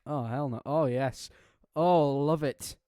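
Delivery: loud, delighted outburst; shouted gleeful reaction; real excitement. Real, not acted. Reader reacts gleefully to the story.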